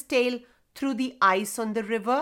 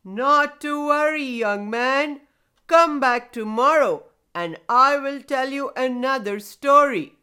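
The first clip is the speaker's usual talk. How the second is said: loud, booming, reassuring elder; hearty resonant voice of a talking stone; comforting grandfather stone; 3 semitones higher, 6 dB louder than usual. A narrator's reading. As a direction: boom out reassurance to a young lad. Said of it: "The grandfather stone comforted".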